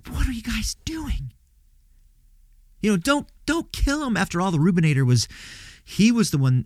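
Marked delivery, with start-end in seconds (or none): 0.1-1.3 urgent whisper. Acted. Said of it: "he, he goes". 2.8-4.2 much higher, begging; high pleading voice. Acted. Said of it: "he, he goes".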